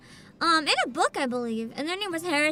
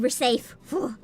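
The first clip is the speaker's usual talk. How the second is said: ordinary talk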